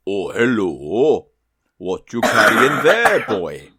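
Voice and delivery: slow, lilting Welsh whale voice; Welsh accent. Acted. Whale up a tree hailing the duck, Welsh accent. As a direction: call out in a put-on Welsh accent. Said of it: "he's from Wales".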